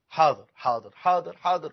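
a touch of low, resigned yielding; compliant father. Acted. Indulgent father agreeing to every demand, mildly compliant. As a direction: give in to the child, word after word.

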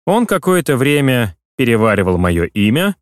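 ordinary delivery, nothing marked

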